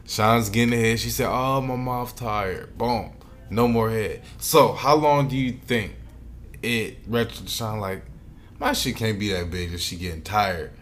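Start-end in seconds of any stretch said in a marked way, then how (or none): none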